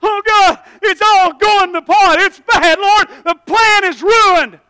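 high, loud, slow panicked wail; mock panic. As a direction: cry out that it's all ruined. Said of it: "we freak out".

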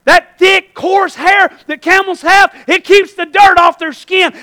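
loud, high preacher's shout; full-force preaching. Acted. Preacher urging congregation to keep dirt out, full-force preaching. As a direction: drive each phrase home to the congregation.

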